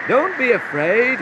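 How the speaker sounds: high, slow, loud call. A narrator's reading. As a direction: call reassurance across the water. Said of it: "called Jesus".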